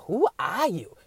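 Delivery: ordinary talk, nothing marked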